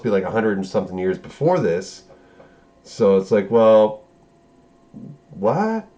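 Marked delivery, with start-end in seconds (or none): 5.3-5.9 rising, baffled what; confused what. Retold or imitated. Fan baffled by the plot, rising what. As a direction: ask it like nothing makes sense.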